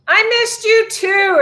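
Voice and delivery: gushing. Real, not acted. Answering Simone's message.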